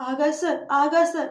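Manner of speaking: slow, drawn-out calls; searching calls for Akash sir; calling out. A narrator's reading. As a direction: call his name across the hill.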